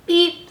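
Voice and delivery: high, short toot; truck horn beep. A narrator's reading. Blue greets the farm animals, high beep. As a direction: beep back like a little truck. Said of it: "said Blue".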